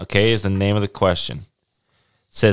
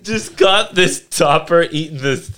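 mock orgasmic; 8 semitones higher than usual. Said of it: "great thing to yell".

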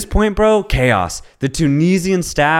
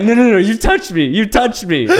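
higher, louder laughing mock protest; gaslighting denial; 7 semitones higher, 4 dB louder than usual. Player denying to the ref, laughing mock protest. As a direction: insist through giggles he touched you.

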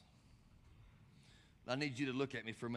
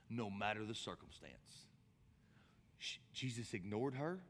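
ordinary talk

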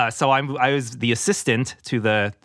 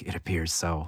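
much lower, softer, throaty "It appears so"; throaty hushed; 9 semitones lower, 10 dB quieter than usual. Shown as a demonstration. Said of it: "a more throaty kinda".

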